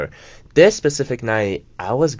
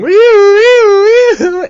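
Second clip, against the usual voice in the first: high, loud wailing siren noise; imitated police siren; 12 dB louder, much slower than usual. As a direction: wail "wee" like a siren, piercingly high. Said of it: "the car behind me starts going".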